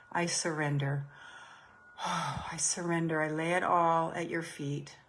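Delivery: guided prayer. Acted. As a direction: pray it softly as a humble plea. Leads a surrender prayer.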